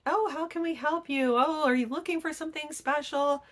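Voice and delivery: high, sugary shop-assistant voice; mock the overly eager salespeople; high sing-song customer-service voice; fake-sweet salesclerk. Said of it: "they're like".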